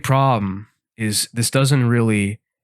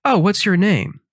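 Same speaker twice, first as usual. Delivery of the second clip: high friendly question; 9 semitones higher than usual. Retold or imitated. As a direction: ask brightly, up high. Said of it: "and say".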